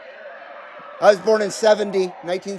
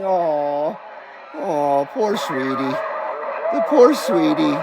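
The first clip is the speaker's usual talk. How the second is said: soft, lower cooing; soothing the dog; 5 semitones lower, 4 dB quieter, slower than usual. Real, not acted. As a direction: coo gently to a frightened pet. Soothing the scared dog Maisie.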